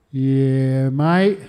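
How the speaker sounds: loud, stretched "yeah" lifting into "mate"; laconic veteran. Retold or imitated. Veteran confirming his service to a caller, loud drawled reply. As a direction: play a comic, drawn-out phone answer. Veteran's repeated phone answer, acted.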